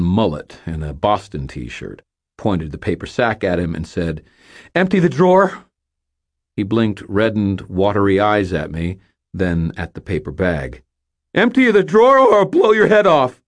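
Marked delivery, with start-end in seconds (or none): 4.7-5.6 high, loud nervous demand; demanding robber. Acted. Young robber demands the cash from the cashier, loud and high. 11.3-13.4 high, loud shout with a voice crack; shouted threat. Acted. Young robber shouts a threat at the cashier, high and loud. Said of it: "I shouted".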